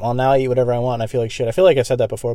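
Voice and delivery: ordinary talk, nothing marked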